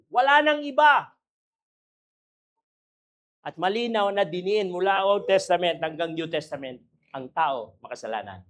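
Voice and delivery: usual speaking voice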